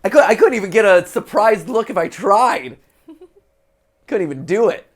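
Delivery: high, loud exasperated outburst; loud exasperation. Real, not acted. Player failing to make a surprised face, loudly exasperated.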